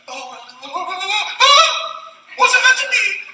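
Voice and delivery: high, whiny mock hesitation; mocking a scared quitter. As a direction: imitate someone backing away nervously.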